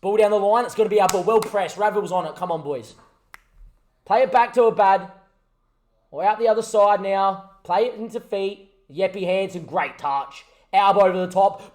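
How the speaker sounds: loud, lively play-by-play call; excited match commentator building to the goal; live commentary. Acted.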